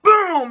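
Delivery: a sharp, high, very loud "Boom!" for the punch; loud punch sound. Retold or imitated. Batman's punch as a sound effect.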